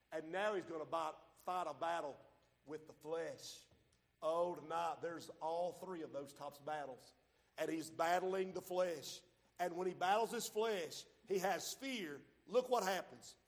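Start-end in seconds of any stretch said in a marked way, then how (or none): none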